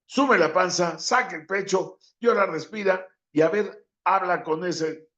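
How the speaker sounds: high-pitched bossy mock coach. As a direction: bark it like a fussy coach. Mocking bad breathing advice.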